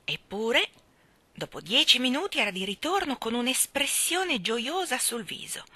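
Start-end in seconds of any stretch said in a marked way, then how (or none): none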